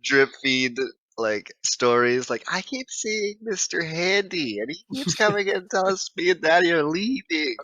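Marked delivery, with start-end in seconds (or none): 2.5-7.6 put-on high-pitched kiddie voice; little kid voice. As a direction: play a small child solemnly reporting a sighting. Voices a child telling stories.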